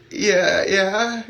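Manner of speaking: high, strained "yeah"; imitating oneself answering through tears; re-enacted tearful answer. Crying adult answering a little kid, high tearful voice.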